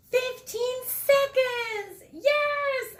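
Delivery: very high, loud, slow jubilant cheer; a kid who just beat the qualifying time. Acted. Andres seeing his time on the timer, jubilant cheer. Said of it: "Andres cheered".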